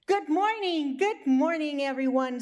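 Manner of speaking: high, loud morning call; cheery greeting. Acted. Rooster calling the sleeping animals, high and loud. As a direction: sing out good morning to everyone.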